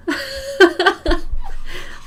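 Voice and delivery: high breathy laugh; nervous laugh. Real, not acted. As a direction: giggle through it. Laugh before sneeze.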